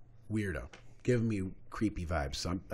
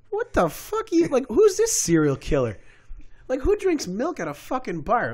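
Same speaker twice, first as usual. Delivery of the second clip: high, loud, retold disbelief; comic rant, voice shooting up; incredulous outburst; 13 semitones higher, 6 dB louder than usual. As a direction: exclaim in exaggerated outraged bafflement. Retold outburst at milk drinker.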